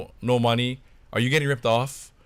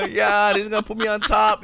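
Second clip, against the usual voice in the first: high gleeful brag; boasting; 10 semitones higher than usual. Retold or imitated. Bragging after the call, high and triumphant. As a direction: gloat about coming out on top. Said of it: "boasting about it".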